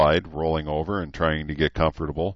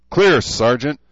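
shouted reply; 7 semitones higher, 7 dB louder than usual. Acted. Recruits answering drill sergeant.

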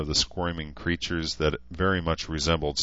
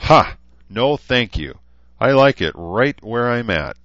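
scoffing laugh; 6 semitones higher, 7 dB louder than usual. A narrator's reading. Celine laughs off offer.

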